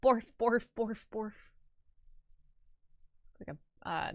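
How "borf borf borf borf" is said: lightly voiced borf-borf dog bark; a hint of a woofing dog; mock dog bark. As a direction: bark back at the dog, playful. Imitating the dog barking.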